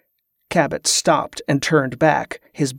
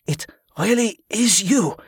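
gasping; 6 semitones higher than usual. A narrator's reading. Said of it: "Dutram gasped".

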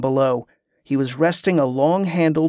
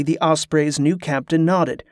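plain reading voice

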